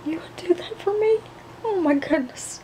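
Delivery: sudden high, thrilled disbelief; delighted surprise. Acted. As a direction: gasp it out, touched and amazed. Surprised by offer of tickets.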